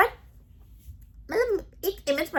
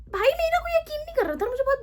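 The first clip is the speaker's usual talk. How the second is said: much higher, squeaky voice of hurt; high whiny complaint; 14 semitones higher than usual. Real, not acted. Storyteller whines that nobody believed her, high-pitched.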